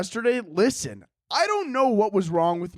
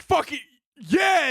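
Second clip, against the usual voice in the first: high mock-outraged agreement; angry flustered agreement; 7 semitones higher, 3 dB louder, slower than usual. Driver thrown by the comic's apology, mock angry agreement. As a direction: imitate a driver thrown off but still mad. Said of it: "he just started agreeing with me".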